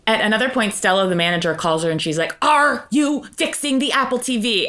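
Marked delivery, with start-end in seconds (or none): 2.4-4.7 mock demanding boss. Manager demanding answer, acted.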